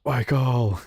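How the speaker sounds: mock shout. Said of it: "Just banging on a door".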